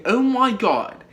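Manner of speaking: mock British accent, deliberately overdone; lightly put-on British accent; bad British accent. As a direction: play a Brit mocking a terrible British accent. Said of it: "just speaking in a British accent".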